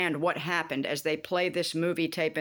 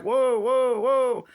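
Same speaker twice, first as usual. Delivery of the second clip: loud, high startled whoa; mock startled whoa; 5 semitones higher, 6 dB louder, much slower than usual. Finn hit with the lawsuit, loud alarmed whoa. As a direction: throw your hands up, loud alarmed whoa.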